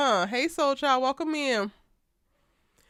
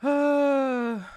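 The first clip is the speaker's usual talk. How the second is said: ordinary talk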